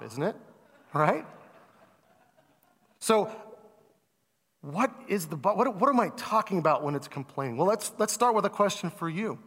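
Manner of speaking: ordinary delivery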